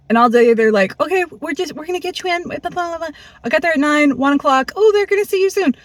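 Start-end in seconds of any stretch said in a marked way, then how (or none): 1.0-3.1 mock placating staff. Staff placating her all day. 4.8-5.7 mock chirpy staff. Staff brushing her off again.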